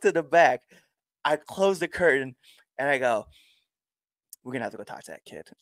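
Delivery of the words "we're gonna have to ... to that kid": quick, much softer, sheepish admission; hushed aside. Retold or imitated. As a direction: drop to a guilty murmur backstage. Guilty aside behind the closed curtain.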